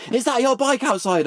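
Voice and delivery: high-pitched mock shout; retold shout. Charlie calls to strangers at the coffee shop, mock shouting. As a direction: imitate an excited shout without much volume. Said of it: "he just shouts".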